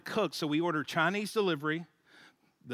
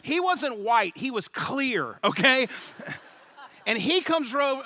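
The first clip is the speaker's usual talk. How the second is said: ordinary delivery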